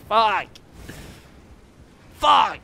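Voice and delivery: loud high swearing; frustrated yell. Real, not acted. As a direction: curse it out loud. Frustrated by death.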